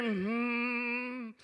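soft closed-mouth moan; low hummed moan. Retold or imitated. Aunt answering with a soft hummed moan. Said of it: "She said".

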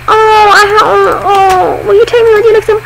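mild mock weeping; tearful plea. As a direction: beg through leftover sobs. Pleading after crying.

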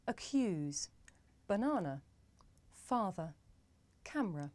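each word said on its own with a light uh in the weak syllable; schwa examples. Shown as a demonstration. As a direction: model Accuse, banana, father, camera one by one for learners. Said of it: "Let's have a look at some examples".

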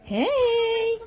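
very high drawn-out call; high-pitched pet talk. Real, not acted. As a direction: coo to get a cat's attention. Calling the cat.

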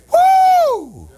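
sudden high joyful woo; high excited whoop. Acted. Preacher whooping at the congregation, high and joyful. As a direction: let out a celebration cry.

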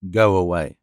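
put-on a hard man sending a child away; low curt man. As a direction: brush the boy off with a low voice. Valjean dismisses the child.

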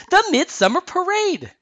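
loud, high-pitched burst of excitement; gleefully finishing someone else's sentence, high and loud; excited interruption. A narrator's reading. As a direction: jump in eagerly at full voice. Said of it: "Anna interrupted, finishing her sister's sentence".

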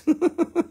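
short high laugh; quick high-pitched chuckle. Real, not acted. Amused at unknown Roman glass.

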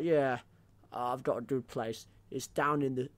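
ordinary talk

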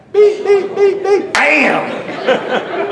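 imitated beeps and crash. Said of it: "the warning sound starts going off".

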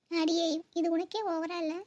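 ordinary delivery

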